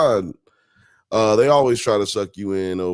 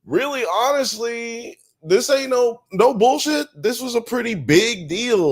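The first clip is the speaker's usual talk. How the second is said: much higher, earnest, swearing it's true; emphatic high insistence; 14 semitones higher than usual. Real, not acted. Streamer insisting to chat, high emphatic voice. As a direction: convince them it's no joke.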